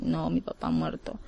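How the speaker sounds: hushed grief. Retold or imitated. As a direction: say it low and barely aloud, holding back tears. Child tells brother father died. (niño que le dice al hermano que papá murió, en voz baja y triste)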